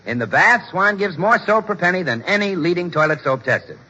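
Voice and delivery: normal talking voice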